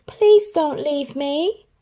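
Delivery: Lady begging her owners not to leave, slow; pleading. Acted. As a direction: beg them not to go, drawn out. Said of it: "she silently pleaded".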